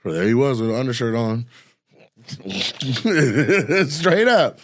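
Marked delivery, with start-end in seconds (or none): 2.3-4.6 laughing. Real, not acted. Laughing at the story.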